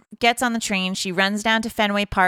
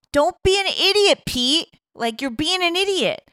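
put-on higher-pitched Boston-accented scolding; 9 semitones higher than usual. Jessa May scolds Pete for being an idiot, in a Boston accent. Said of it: "very Boston of her".

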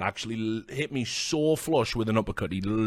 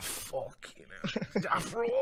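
mock dismay; 8 semitones higher, 10 dB quieter, much slower than usual. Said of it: "I was like".

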